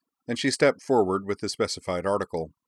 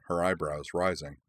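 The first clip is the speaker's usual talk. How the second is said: ordinary delivery, nothing marked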